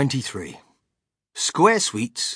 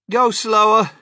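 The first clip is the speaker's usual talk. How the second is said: high, loud breathless plea; panting; 9 semitones higher, 10 dB louder than usual. A narrator's reading. Missus Salt out of breath on stairs, panting. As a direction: beg them to wait, as if winded. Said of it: "panted Missus Salt".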